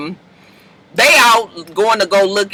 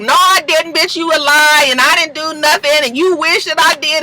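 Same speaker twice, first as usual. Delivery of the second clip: mock yell; 6 semitones higher than usual. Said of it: "starting to yell at her".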